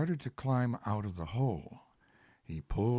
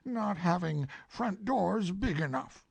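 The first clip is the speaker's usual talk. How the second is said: mildly cross Pooh; 11 semitones higher than usual. Acted. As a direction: grumble it, put out. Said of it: "said Pooh crossly".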